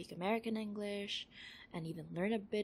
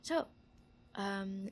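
ordinary delivery

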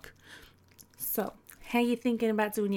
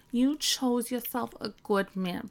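normal talking voice

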